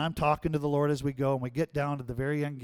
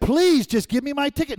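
much higher and louder, pushy demand; strained, high, loud plea; pleading anger; 10 semitones higher, 7 dB louder than usual. Retold or imitated. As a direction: beg angrily at the counter. Arguing at airline counter.

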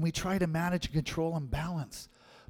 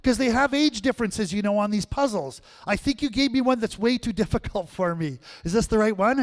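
put-on much higher, louder frustrated little girl; whiny child yelling; 8 semitones higher, 7 dB louder than usual. Granddaughter calling from the living room, loud high whine. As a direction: whine and call out like a fed-up kid. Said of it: "And she yells out".

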